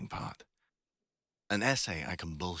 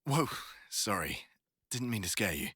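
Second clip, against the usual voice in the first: usual speaking voice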